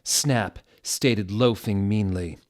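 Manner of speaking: ordinary delivery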